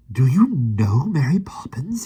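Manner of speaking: high, loud, slow eager demand; Michael demanding of Amor. A narrator's reading. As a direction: press him for an answer. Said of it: "he demanded".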